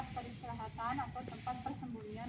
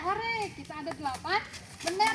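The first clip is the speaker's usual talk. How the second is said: happy yay; 5 semitones higher, 9 dB louder than usual. Real, not acted. Teacher cheers after counting flags.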